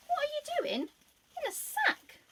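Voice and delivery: very high, loud astonished question; lady finding a boy inside the sack. Acted. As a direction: squeak it out in disbelief.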